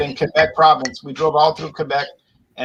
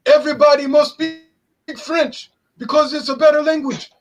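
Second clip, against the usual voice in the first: much higher, haughty dictating voice; mock French decree; 9 semitones higher, 3 dB louder than usual. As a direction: play the bossy French official.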